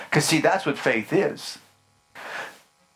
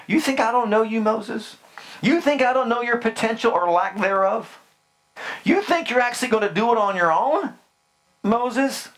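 challenging God; 7 semitones higher than usual. Acted.

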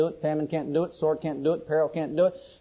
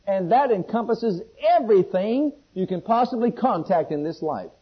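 measured, building list in a raised voice; declaimed scripture; 4 semitones higher, 5 dB louder than usual. Acted. Preacher reading Paul's persuasion to the congregation, declaimed with force. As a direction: proclaim each item separately, building in force.

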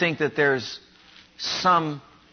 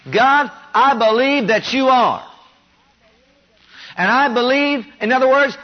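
louder and higher, ringing prayer declaration; bold confession of faith; 7 semitones higher, 4 dB louder than usual. Pretend. Preacher voicing a believer praying to God, loud and fervent. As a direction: proclaim each "I believe" to God with full conviction. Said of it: "I must believe something".

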